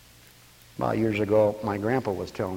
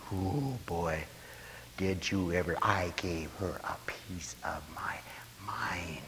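soft whispered boast; hushed gossip; 6 semitones lower, 12 dB quieter, slower than usual. Retold or imitated. Preacher playing a gossip bragging she told someone off, whispered.

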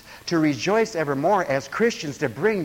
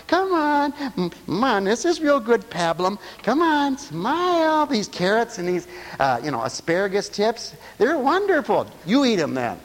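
put-on higher sing-song coaxing a baby to eat; coaxing parent; 5 semitones higher than usual.

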